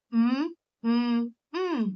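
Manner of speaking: syllable-counting hums; closed-mouth hum. Shown as a demonstration. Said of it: "मैंने कितने बार हम किया".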